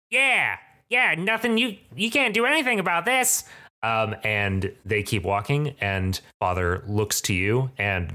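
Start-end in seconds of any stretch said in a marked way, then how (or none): 0.1-3.4 very high, put-on creature voice; jeering sidekick. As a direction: chime in as a squeaky, taunting henchman. Sidekick jeers after the leader.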